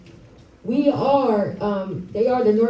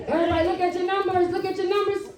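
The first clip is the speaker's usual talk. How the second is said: higher, fast emcee call to the crowd; rally the audience to check tickets; announcer call-out; 8 semitones higher, faster than usual. Real, not acted. Calling out raffle instructions to crowd.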